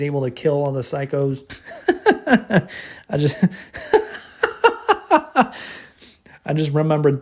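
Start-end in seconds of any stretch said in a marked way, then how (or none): 1.5-2.9 real laugh. Real, not acted. Laughing recalling the movie. 4.4-5.7 a burst of high-pitched real laughter. Real, not acted. Reviewer cracking up at the movie, high real laugh.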